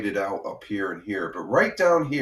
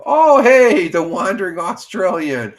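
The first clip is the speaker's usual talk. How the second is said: excited greeting; 7 semitones higher, 8 dB louder than usual. Real, not acted. Greeting a returning viewer.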